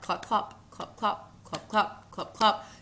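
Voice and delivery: "clop, clop" in even pairs; spoken hoofbeats, light and rhythmic; horse hoof imitation. Shown as a demonstration. Teacher imitates Frost's horse for students, rhythmic clopping. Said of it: "horse hoof sounds".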